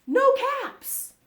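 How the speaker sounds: loud, high storybook dismay; dismayed discovery. A narrator's reading. Narrator to children as the peddler's search fails, loudly dismayed.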